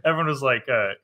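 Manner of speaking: ordinary delivery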